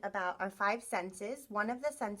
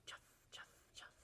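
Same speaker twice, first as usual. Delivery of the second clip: soft breathy chuff; whispered chuff; 25 dB quieter, faster than usual. Shown as a demonstration. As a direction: whisper it like shoes on a path. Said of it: "They make a soft sound".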